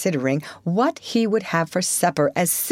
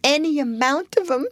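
high put-on washerwoman voice, mildly sobbing; 8 semitones higher than usual. A narrator's reading. Toad as washerwoman telling the driver about kids, mild sobbing. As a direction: sob it in a higher disguise voice. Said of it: "sobbed Toad".

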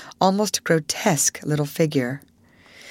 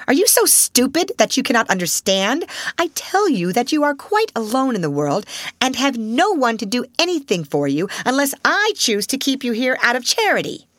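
angry headmistress; 7 semitones higher than usual. Acted. Headmistress berates the orphan.

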